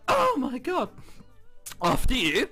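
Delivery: ordinary talk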